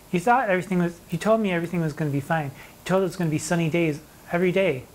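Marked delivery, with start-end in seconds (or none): none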